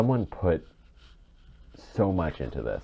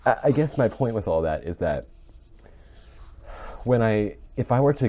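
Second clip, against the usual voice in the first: tearful; 7 semitones higher than usual. Real, not acted. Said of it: "I'm actually crying".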